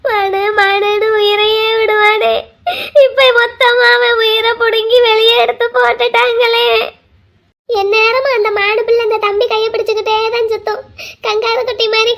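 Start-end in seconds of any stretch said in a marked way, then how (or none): 0.0-6.7 slow, drawn-out keening; tearful lament. Acted. Relative grieves for his lost love, tearful wailing. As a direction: mourn for him, stretching the words.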